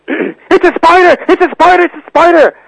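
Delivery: high, slow panicked shriek; shrieking old aunt. Retold or imitated. Aunt voiced screaming about spider.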